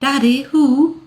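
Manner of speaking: put-on high, bright little girl question; toddler voice. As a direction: ask like a small child. Toddler asking her father.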